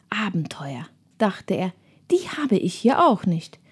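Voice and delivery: soft whispered inner voice; whispered thought. Acted. Tim thinking to himself.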